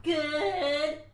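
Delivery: loud, high, strained mock "good"; tone contradicts word. Shown as a demonstration. Answering how are you today, exaggeratedly un-good tone. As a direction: blurt it so it clearly isn't good. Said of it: "the word doesn't mean shit".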